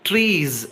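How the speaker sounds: ట్రీస్ with long vowel and buzzing z; z ending. Shown as a demonstration. Teacher giving a second example plural, z ending. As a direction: stretch ట్రీస్ into "treezz". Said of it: "జ sound".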